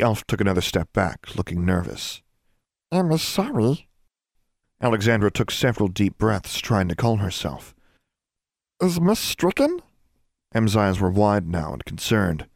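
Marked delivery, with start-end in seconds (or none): 2.9-3.9 high-pitched, timid elf apology; nervous house elf. A narrator's reading. House elf backing away from the girl, nervous high voice. 8.8-9.8 high elf voice, rising question; concerned house elf. A narrator's reading. House elf worrying over the girl, high concerned voice.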